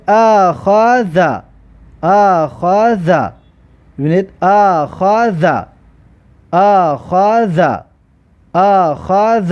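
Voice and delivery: اخذ drawn out very slowly, each sound held; teacher stretches اخذ slowly for students; slow demo pronunciation. Shown as a demonstration. Teacher models اخذ very slowly for pupils. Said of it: "ببینید مثلا که کلمه را ببینید".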